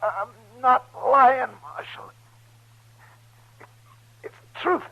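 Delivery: strained high pleading cry; desperate dying plea. Acted. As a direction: beg him to believe you with your last breath. Dying man insists truth.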